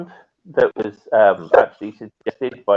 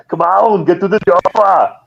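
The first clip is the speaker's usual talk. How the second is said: higher, louder hammed-up action-hero bark; Arnold impression; 8 semitones higher, 4 dB louder than usual. Host slips into an Arnold voice for callers, hammed-up action-hero shout. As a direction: shout it like a movie muscleman urging his squad. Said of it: "Now I'm starting to sound more like Arnold".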